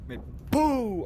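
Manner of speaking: high loud startled shout; startled yell. Real, not acted. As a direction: jump out of your skin. Jump scare at pickup.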